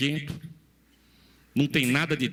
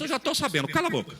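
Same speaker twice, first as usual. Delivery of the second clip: blunt brush-off; 6 semitones higher than usual. Retold or imitated. Eliseu snaps back.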